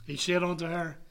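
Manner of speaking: ordinary talk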